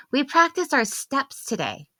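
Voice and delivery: put-on high, bright child's voice; little girl voice. Daughter telling mom from the back seat, childlike voice. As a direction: speak as an eager small daughter. Young daughter speaking in story.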